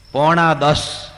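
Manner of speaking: blurting the time out loudly; deaf man's loud answer. Retold or imitated. Deaf man answers the time, mock loud. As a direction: answer loud like someone wearing headphones. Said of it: "પાછો બેરો જોરથી બોલે".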